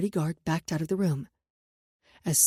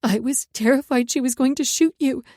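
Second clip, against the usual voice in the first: higher, louder, tearful outburst; crying; 5 semitones higher, 8 dB louder than usual. A narrator's reading. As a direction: let the fear spill out through tears. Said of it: "but I started crying instead".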